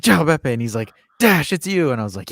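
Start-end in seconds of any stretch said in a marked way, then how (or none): 0.0-0.9 retold greeting, called out high and loud; excited greeting. Greeting Beppe on his live stream, excited. 1.2-1.9 imitated delighted shout of welcome; excited greeting. Streamer spots Dash in his chat, excited.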